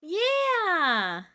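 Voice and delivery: loud, high whoop of joy; excited cheer. Real, not acted. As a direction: cheer it out like you just won.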